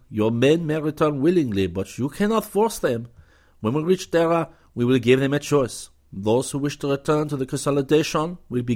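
gracious Frenchman welcoming a lieutenant; cordial French commander. A narrator's reading. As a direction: greet the young hero with courtly charm.

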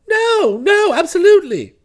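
high, loud flustered protest; frantic denial. Acted. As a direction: cut in and deny it desperately. Bertie interrupts Florence's accusation.

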